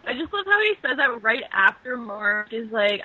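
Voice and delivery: ordinary delivery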